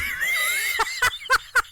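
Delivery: real high burst of laughter; helpless shrieking laugh. Real, not acted. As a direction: crack up at your own joke. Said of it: "laughs at own line".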